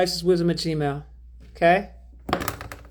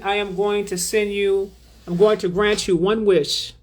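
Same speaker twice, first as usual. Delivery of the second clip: ordinary talk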